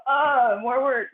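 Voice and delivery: put-on high, loud childish groan of complaint; little girl whining. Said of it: "the little girl in me was like".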